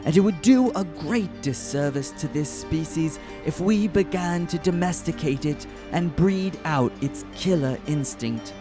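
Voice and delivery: ordinary talk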